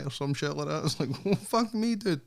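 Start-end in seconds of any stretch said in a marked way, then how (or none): 1.2-2.2 high, incredulous well fuck me; mock disbelief. Reacting to a podcast guest, high mock disbelief. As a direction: scoff in disbelief, pitched way up.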